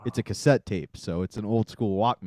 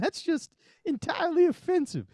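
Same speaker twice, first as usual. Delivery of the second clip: school principal. Acted. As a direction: protest in a piercingly high principal voice. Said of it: "the principal of the school".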